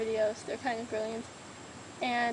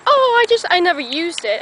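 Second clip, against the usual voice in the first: mock friend quote; 5 semitones higher, 10 dB louder than usual. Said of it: "and you were like".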